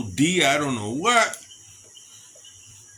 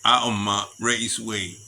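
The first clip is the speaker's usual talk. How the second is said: normal talking voice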